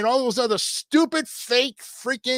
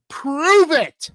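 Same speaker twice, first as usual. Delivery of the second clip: louder, higher punch on Prove it; challenge; 7 semitones higher, 7 dB louder than usual. Retold or imitated. Taunting trolls with the words they hate, loud dare. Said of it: "a troll cannot stand is the words".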